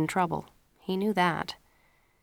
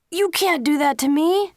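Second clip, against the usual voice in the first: protesting son; 10 semitones higher, 6 dB louder than usual. A narrator's reading.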